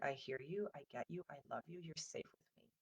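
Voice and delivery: tender reassurance. Shown as a demonstration. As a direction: reassure the child they are safe. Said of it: "the empathy and the validation is just about saying".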